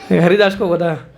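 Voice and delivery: talking through the tail of a laugh; amused, laugh still in the voice. Real, not acted. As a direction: finish the story while chuckling. Still laughing after the punchline.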